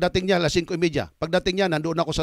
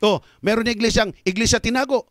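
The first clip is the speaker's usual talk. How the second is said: ordinary delivery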